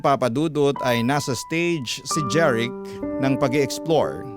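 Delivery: usual speaking voice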